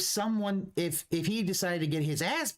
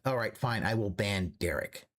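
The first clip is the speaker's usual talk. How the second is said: mock grudging quote; 10 semitones lower than usual. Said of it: "him to be like".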